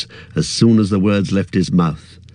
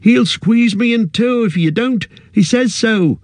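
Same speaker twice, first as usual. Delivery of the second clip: high, frantic pleading; desperate, trapped and pleading; trapped hobbit begging his friends; panicked plea; 12 semitones higher than usual. A narrator's reading. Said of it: "cried Merry".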